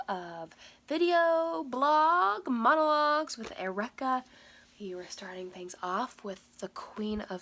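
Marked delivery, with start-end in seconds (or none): 0.9-4.2 loud, high, slow title flourish; show-title announcement. Acted. Vlogger welcoming viewers to another video, grand title announcement. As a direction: stretch out the show name like a big intro.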